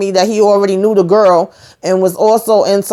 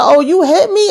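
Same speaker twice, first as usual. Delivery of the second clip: mock disbelief; 9 semitones higher than usual. Retold woman reacting to a punch.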